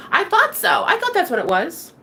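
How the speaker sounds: sing-song triumphant high voice; mock realization.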